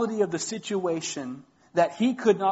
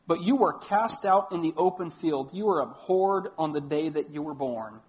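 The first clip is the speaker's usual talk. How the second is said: scripture reading; 5 semitones higher, 5 dB louder than usual. Acted. Reads Ezekiel passage aloud.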